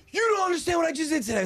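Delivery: put-on high, rushed kid's gush; excited child. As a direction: imitate a daughter bursting with news. Excited daughter reports her day.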